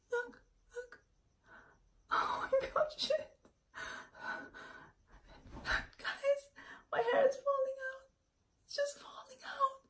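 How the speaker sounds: high, whimpering, half-whispered; near tears. Real, not acted. Showing viewers her hair falling out, tearful and high. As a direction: voice cracking high as she starts to cry.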